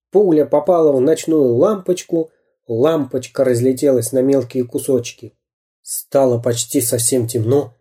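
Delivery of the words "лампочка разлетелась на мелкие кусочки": plain reading voice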